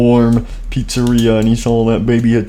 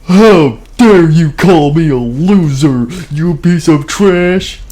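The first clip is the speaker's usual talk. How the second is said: furious; 6 semitones higher, 4 dB louder, slower than usual. Acted. Character insulted, shouting back.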